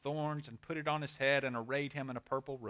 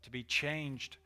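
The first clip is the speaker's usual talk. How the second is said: reverent prayer; 4 semitones lower, 7 dB quieter than usual. Real, not acted. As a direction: pray quietly, phrase by phrase. Leads a closing prayer.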